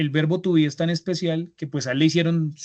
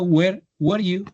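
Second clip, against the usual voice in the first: "Where were you?" said slowly and clearly, words apart; slow repeat; 4 semitones higher, slower than usual. Shown as a demonstration. Teacher repeating the question for listeners, slow and clear. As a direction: give "Where were you?" slowly so students can catch it. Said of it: "¿Lo han escuchado?".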